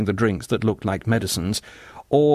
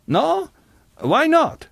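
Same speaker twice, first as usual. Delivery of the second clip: very high-pitched questions; high, incredulous challenge; challenging singer; 4 dB louder, much slower than usual. A narrator's reading. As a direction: throw it back at him, very high.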